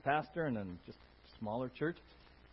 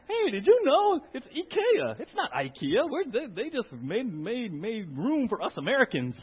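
eager trivia sharing; 7 semitones higher, 9 dB louder, slower than usual. Retold or imitated. Imitating listener sharing trivia.

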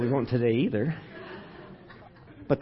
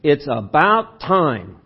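very slow and louder, leaning on about; slow, drawn out; 8 dB louder, much slower than usual. Shown as a demonstration. Pastor demoing another inflection to the congregation, slow and emphatic. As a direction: stretch each word out, emphatic and pointed. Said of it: "you take it an entirely different way when I say it that way".

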